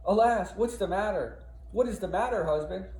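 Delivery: high, loud breathless alarm; panicked wife rushing in. Acted. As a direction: burst in asking what happened. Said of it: "Amelia comes running in".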